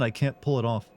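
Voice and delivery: ordinary talk, nothing marked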